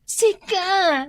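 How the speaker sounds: high, loud pointing exclamation; excited flattery. Acted. Office mate flattering Daisy about promotion, high and loud. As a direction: cheer her on, high and loud.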